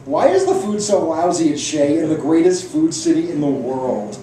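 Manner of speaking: mock outrage at the stadium food; indignant outburst. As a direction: rail against the lousy food. Indignant complaint about stadium food.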